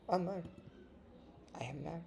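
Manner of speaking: usual speaking voice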